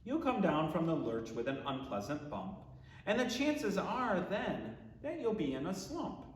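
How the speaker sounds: ordinary talk, nothing marked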